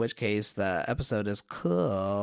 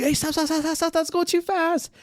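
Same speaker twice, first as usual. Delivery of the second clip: high, rapid-fire pleading to stop; hammed-up alarm, pitched way up; frantic rider, played for laughs; mock panic. Rider panics as wagon speeds up.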